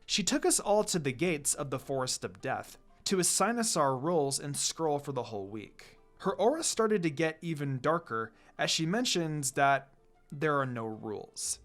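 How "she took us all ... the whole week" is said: usual speaking voice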